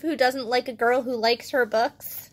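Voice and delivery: ordinary delivery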